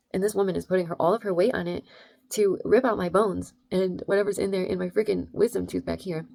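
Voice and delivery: usual speaking voice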